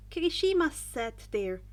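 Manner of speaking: ordinary delivery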